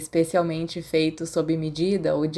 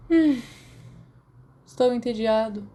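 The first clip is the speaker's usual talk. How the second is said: bored sigh; 5 semitones higher than usual. Acted. Sirius moaning to Remus that he is bored. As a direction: sigh the words out, fed up. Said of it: "Sirius deu um longo suspiro".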